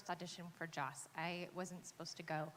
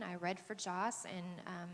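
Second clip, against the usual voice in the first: ordinary delivery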